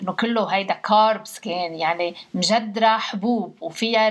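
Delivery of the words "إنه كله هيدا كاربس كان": ordinary talk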